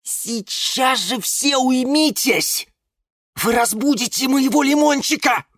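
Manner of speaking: very high, put-on lemon voice; high-pitched protective scolding; indignant cry. A narrator's reading. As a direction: cry out to silence everyone. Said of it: "закричал Лимон Филимон".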